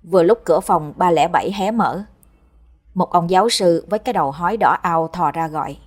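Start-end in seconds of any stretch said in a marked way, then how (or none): none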